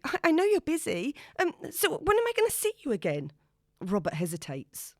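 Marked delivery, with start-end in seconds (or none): 0.0-3.3 high, loud nervous rush; anxious and eager, voice climbing; nervously pushing to see him again. A narrator's reading. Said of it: "She laughs nervously".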